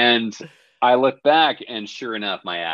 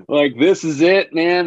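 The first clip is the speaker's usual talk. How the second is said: panicked; 10 semitones higher, 5 dB louder, slower than usual. Retold or imitated. Panic at being thrown off the pier.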